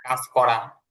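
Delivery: ordinary delivery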